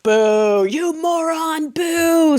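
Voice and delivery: high, drawn-out boos; jeering crowd. Retold or imitated. Crowd erupts at the speaker, jeering in high drawn-out boos. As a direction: heckle like an outraged room. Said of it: "And the room erupts".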